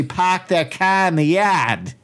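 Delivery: broad Massachusetts accent with the r's dropped. Shown as a demonstration. Massachusetts native mocking how actors do locals, broad Massachusetts accent. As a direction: say "park their car in the yard" as "pahk theah cah in the yahd", Massachusetts style. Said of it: "I'm from Massachusetts".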